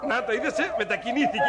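high, gleeful and laughing; man boasting to the girl while laughing. Acted. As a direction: crow about it with glee. Showing off the trick.

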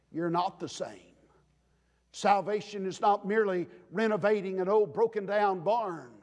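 ordinary delivery

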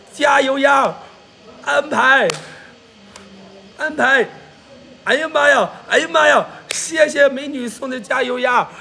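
high, loud livestream hype; whooping with delight at a present; excited gift shout-out. Real, not acted. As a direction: holler thanks for the gift. Thanking a viewer for gifts.

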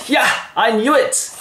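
high, gloating cry; triumphant Hamlet. Acted. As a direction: crow it in a high voice. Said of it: "And Hamlet says".

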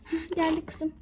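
ordinary delivery

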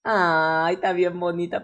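ordinary delivery

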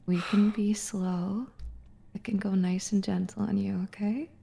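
slow, hushed, teasing flirt; sultry whisper. Pretend. Streamer teasing her flustered friend with a slow sultry whisper. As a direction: purr it out slowly, almost whispering.

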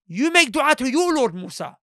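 loud, high, slow demand; acted arrogance, leaning loudly on "your Lord"; arrogant. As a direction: order him about with lofty disdain. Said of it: "their arrogance. They say".

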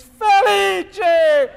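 high, loud, drawn-out shriek of a name; dramatic scream. Retold or imitated. Aunt spotting her relative from up the hill, dramatic scream. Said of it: "and she screams".

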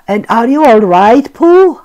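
loud anxious question; worried call. A narrator's reading. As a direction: call out in concern. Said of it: "asked Owl".

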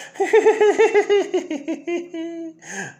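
a high, loud, shrieking cackle; maniacal laugh. Acted. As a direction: cackle wildly, like a madman. Joker laughs after his joke.

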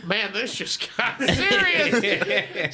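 slow, laughing disbelief; amused mock alarm. Bystander realizing it got serious, slow amused alarm. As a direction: draw it out through a laugh.

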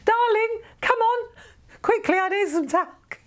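high, theatrical plea for talc; Helen Whitehead impression. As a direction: mimic a fussy lady calling for talc. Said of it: "now I can hear Helen Whitehead".

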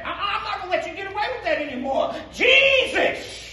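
preaching shout. Real, not acted.

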